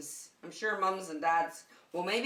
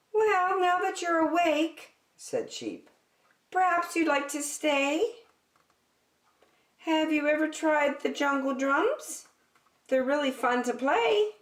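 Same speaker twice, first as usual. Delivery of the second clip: higher, friendly sheep voice; Sheep's voice; 8 semitones higher, 5 dB louder than usual. Acted. Sheep inviting the sleepy bear to stay, higher and eager.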